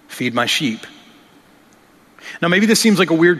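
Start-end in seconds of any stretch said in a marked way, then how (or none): 0.1-0.8 tender Jesus. Acted. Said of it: "Jesus said so tenderly".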